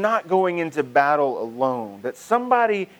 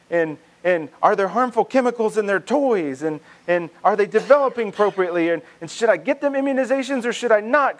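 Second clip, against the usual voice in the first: higher, rushed worried questions piling up; anxious parent; 7 semitones higher than usual. Retold or imitated. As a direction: play a fretful new parent spiralling. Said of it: "you're like".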